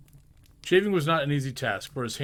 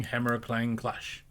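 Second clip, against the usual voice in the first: ordinary delivery, nothing marked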